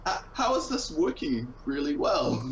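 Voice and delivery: high, slow, incredulous; drawn-out disbelief at how good it sounds; amazed question. Retold or imitated. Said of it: "I was like".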